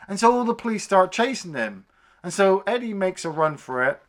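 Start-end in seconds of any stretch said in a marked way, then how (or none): none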